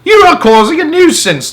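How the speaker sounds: very high, loud bellow; angry bellow. A narrator's reading. Policeman bellowing at Henry about the crowd. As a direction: bellow it like an order. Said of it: "the police bellowed".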